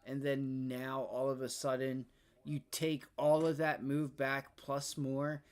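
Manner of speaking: ordinary talk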